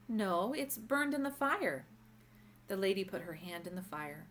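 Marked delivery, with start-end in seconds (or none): 0.1-1.9 put-on high, slow, upset child; a child wailing over her lost ball; dismay. A narrator's reading.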